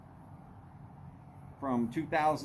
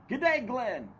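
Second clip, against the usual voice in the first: high, hearty mock-Aussie greeting; bad Aussie accent; 9 semitones higher, 3 dB louder than usual. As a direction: call out in a poor Australian accent. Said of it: "my Aussie accent is horrid".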